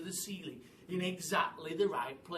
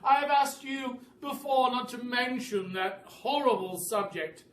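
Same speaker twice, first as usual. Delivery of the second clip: high, loud offended bird voice; indignant bird; 5 semitones higher, 8 dB louder than usual. A narrator's reading. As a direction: protest loudly at the horrid subject. Indignant roly-poly bird.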